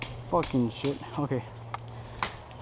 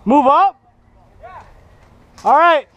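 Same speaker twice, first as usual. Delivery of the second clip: loud, high urgent calls; shouting callouts; 9 semitones higher, 8 dB louder, slower than usual. Real, not acted. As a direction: yell it across the field. Callouts in a firefight.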